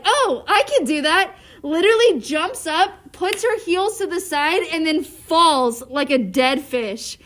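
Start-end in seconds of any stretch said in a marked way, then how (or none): 0.0-1.3 eager, higher-pitched imitation of a confident claim; mock-confident quote, pitched up; imitating Allie. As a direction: retell it as a cocky, excited boast. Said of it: "Allie was like".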